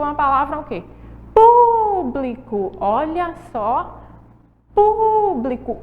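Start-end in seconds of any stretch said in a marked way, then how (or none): 1.3-2.7 Público called high and loud, pu drawn out; stressed first syllable. Shown as a demonstration. Teacher calling the word to show its strong syllable. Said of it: "O pu é a sílaba mais forte". 4.7-5.8 Público sung out high and slow, pu held; stressed first syllable. Shown as a demonstration. Teacher repeating the word to show pu is strongest. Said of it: "O pu é a sílaba mais forte".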